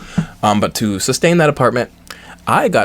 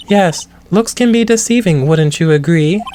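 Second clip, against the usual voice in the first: higher, lightly voiced lady witness; high put-on woman's voice; 6 semitones higher, 4 dB louder than usual. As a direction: play the poised witness. Voicing witness again.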